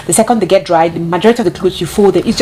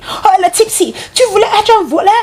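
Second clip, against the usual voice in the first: imitated screaming; 13 semitones higher than usual. Imitating the nanny screaming at Caden, shrill mock scolding. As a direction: mimic a harsh scolding in a much higher voice. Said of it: "she was screaming at, screaming at Caden".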